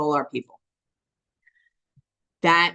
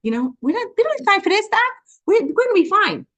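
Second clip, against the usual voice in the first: mock defiance; 11 semitones higher, faster than usual. Patient brushing off the psychiatrist referral, breezy defiance. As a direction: toss it off like it's nothing, doc. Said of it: "I was like".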